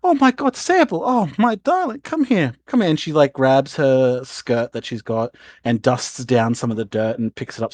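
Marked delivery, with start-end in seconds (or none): 0.0-2.9 high-pitched, gushing mum welcome; acted motherly delight. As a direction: greet a long-missed child and beckon them over. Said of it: "your mother notices you and goes".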